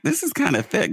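high, wondering mom voice; a sleepy mom noticing the oil is thick, pitched high; mom's aside. Retold or imitated. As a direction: puzzle over it like a tired mom. Mom voiced pouring detergent.

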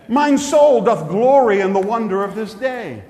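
put-on loud, grand Old English voice; olde speech. Shown as a demonstration. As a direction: proclaim it like a Renaissance fair herald. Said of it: "naturally speak in Old English".

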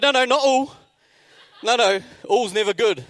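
high, loud comic alarm; alarmed protest. Acted. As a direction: protest in mock panic. Alarmed protest to dental nurse.

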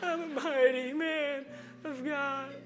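put-on slow, laughing-through-tears boy; a little boy's shaky brave declaration, lightly played; tearful child declaring. Preacher voicing his son at drop-off, tearful brave child.

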